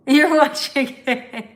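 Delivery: bursting into loud laughter, slow. Real, not acted. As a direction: laugh hard at the viewer. Laughing at stalker in chat.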